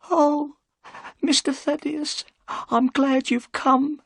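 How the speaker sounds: put-on trembling old housekeeper; frightened housekeeper. A narrator's reading. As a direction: plead with relief and fear.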